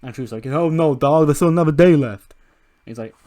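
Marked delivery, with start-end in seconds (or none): 0.5-2.3 loud, high-pitched acted protest; hammed-up shouted correction; mock exclamation. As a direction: call it out loudly as an animated character. Voicing Truth correcting the early celebration.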